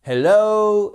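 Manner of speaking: "Hello" high and loud with an oh-oo glide; English HELLO. Shown as a demonstration. Teacher recalling the diphthong, "Hello" high with long glide. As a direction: breathe the h, stretch the o of "Hello". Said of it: "vous vous souvenez de celui-ci".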